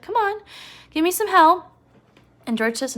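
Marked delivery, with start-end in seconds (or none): 0.0-1.7 high, loud mock prodding; urging. Prodding a friend to give some hell, high and loud. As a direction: imitate someone egging a friend on, pitched up. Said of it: "George is like".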